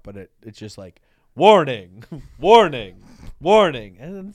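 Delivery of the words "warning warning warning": loud, evenly repeated alert; alarm voice. Retold or imitated. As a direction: imitate an alarm clock calling out. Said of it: "alarm clock going off".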